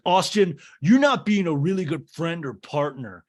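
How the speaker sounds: hint of a petulant Craig; mock whiny Craig. Craig chiding Austin about being a bad friend, mock whiny.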